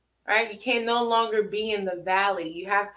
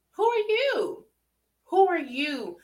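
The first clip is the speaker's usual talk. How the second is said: indignant; 7 semitones higher than usual. Acted. Rebuking the judgers.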